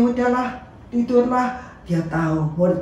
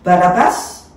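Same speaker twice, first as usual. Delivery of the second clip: louder, raised address; calling to the crowd; 4 semitones higher, 6 dB louder than usual. Acted. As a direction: call out over the crowd.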